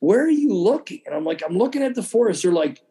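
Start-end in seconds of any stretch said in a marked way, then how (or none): 0.0-1.0 high, puzzled guide asking; mock-puzzled where-are-you-looking; lightly voiced guide asking; retold guide question. 1.5-2.4 high, baffled reply; mock-bewildered answer; retold confused answer.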